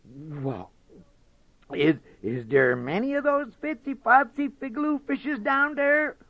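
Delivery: high, faltering fox voice; hesitant Brer Fox. Acted. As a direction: stammer it out, curious fox.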